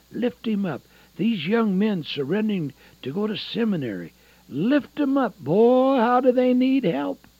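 high, swelling appeal; fervent preaching. Real, not acted. Preacher urging prayer for young seminarians, fervent preaching. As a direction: cry out the plea for support.

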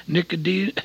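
normal talking voice

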